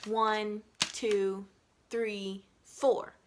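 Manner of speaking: spaced-out beat count; measured counting. Shown as a demonstration. Teacher counting the beats aloud, slow and measured. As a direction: count slowly with a pause between numbers. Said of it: "you could also count this as".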